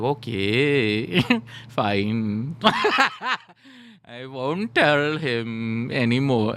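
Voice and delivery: put-on drawn-out Indian accent through laughter; laughing, hammed-up Indian-accented promise; mock Indian accent. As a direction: give in teasingly in a slow, put-on Indian accent. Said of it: "I perfected the Indian accent".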